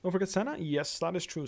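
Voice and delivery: ordinary delivery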